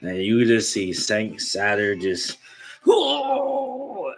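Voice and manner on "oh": high, drawn-out moan of pain; agonized groan. Acted. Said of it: "agonizing in pain".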